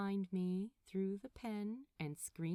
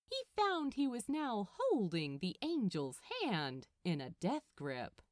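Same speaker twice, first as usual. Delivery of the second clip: plain reading voice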